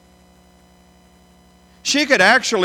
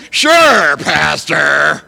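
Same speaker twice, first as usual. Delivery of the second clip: sarcastic sure; 7 dB louder, much slower than usual. Pretend. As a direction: sneer it back at the pastor. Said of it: "when in reality you're saying".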